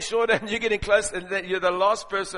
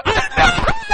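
high loud frightened cry; mock scream; 9 dB louder than usual. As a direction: shriek in fake terror. Dread at first photo.

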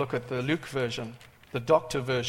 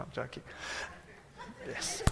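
usual speaking voice

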